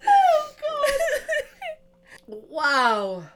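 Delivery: breathless recovery from laughter; recovering from a laughing fit; after laughing hard. Real, not acted. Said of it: "I'm crying laughing already".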